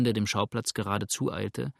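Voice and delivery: ordinary delivery, nothing marked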